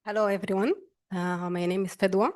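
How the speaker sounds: ordinary talk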